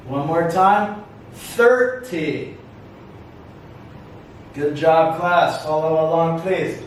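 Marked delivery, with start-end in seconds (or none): 1.3-2.6 THIR in "thirty" pitched up, then falling; "thirty" slowly, lifting the first syllable; stress on first syllable. Shown as a demonstration. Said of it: "Listen for the stress".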